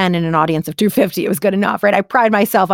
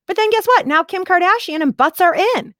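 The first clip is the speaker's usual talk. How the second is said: much higher and louder, incredulous; high incredulous exclamation; excited; 9 semitones higher, 4 dB louder than usual. Real, not acted. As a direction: pitch it up high in disbelief. Mock outrage at changed fashion.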